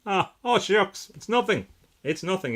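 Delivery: high, coy "Ah, oh, shucks"; bashful voice for Sam. Retold or imitated. Host voicing his friend's bashful reply, high and coy. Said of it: "Sam says, "Oh, shucks".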